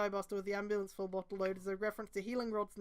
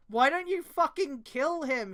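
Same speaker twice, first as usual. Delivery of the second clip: exasperated yell; 5 semitones higher, 7 dB louder, slower than usual. Real, not acted. Exasperated outburst at the story.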